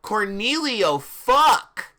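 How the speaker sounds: loud, high mock-shocked announcement; hammed-up shout; dramatic reveal. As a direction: belt the name out as a big reveal. Dramatic reveal of character name.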